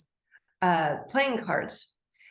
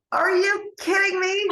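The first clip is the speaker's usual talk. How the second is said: much higher, louder stunned cry; shocked disbelief; 12 semitones higher, 4 dB louder than usual. Retold or imitated. Retelling her reaction to her brother's news of the crash, high shocked cry. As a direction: squeal it out in disbelief. Hearing of dad's plane crash.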